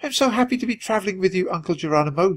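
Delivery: put-on high, childlike little mouse; shivery start, then cheery high chatter; cheerful squeak. A narrator's reading. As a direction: pipe up happily like a small nephew. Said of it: "he squeaked cheerfully".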